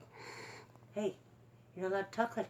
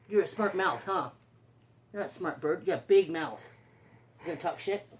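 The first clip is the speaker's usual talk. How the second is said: normal talking voice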